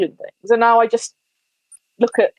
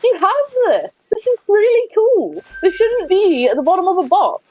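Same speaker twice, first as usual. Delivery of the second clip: put-on excited teen; 8 semitones higher than usual. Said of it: "I was like".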